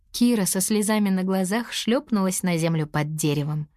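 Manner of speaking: usual speaking voice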